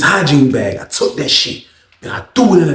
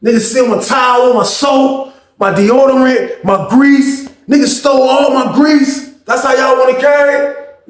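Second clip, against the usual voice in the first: indignant rant; 9 semitones higher than usual. Retold or imitated. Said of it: "He like".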